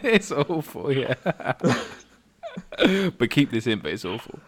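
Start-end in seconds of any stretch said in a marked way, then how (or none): 0.0-1.2 laughing through the words. Real, not acted. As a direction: giggle while saying it. Laughing at co-host's helmet voice.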